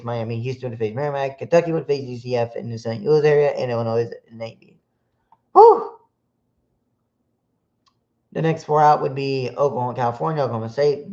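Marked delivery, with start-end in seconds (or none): 5.5-6.0 a big, high, loud whew of relief; relieved whew. Real, not acted. After reading the whole bracket, a loud relieved whew. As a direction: blow out a loud whew after the long list.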